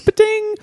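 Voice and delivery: bright mock coin chime; coin chime sound. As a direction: chirp it like a game coin. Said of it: "the coin noise".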